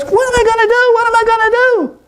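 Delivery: very high squeaky wail; hammed-up frustration in a monkey squeal; frustrated monkey. Monkey cannot pick up all the nuts, frustrated squeal. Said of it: "he got really frustrated".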